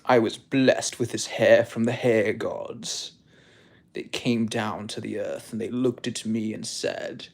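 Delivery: hammed-up vain guru; mock guru voice. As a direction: tell it like a sacred legend. Continues mock guru monologue.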